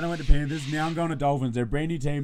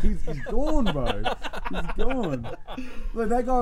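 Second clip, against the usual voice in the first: laughing; 8 semitones higher, 3 dB louder, slower than usual. Real, not acted. Retelling while laughing.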